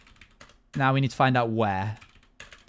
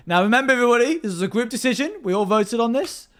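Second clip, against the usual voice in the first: imitating Robert; 8 semitones higher, 4 dB louder than usual. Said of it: "Robert immediately went from".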